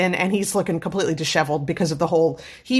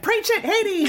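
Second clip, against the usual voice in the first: high cheering shout of encouragement; cheering him on; slower than usual. Retold or imitated. Fan cheering Hades on while recapping the comic, high and gleeful. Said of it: "And I'm like".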